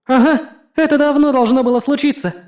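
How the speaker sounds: high eager exclamation; exclaiming. Acted. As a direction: burst it out with glee. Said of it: "воскликнула Физзи".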